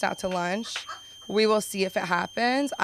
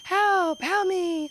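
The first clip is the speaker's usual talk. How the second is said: mock kid yelling; 10 semitones higher, much slower than usual. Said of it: "but, like".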